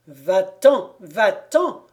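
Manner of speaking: loud, repeated order to leave; forceful blacksmith's shout. A narrator's reading. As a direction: cry out to drive him away. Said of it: "cria le forgeron".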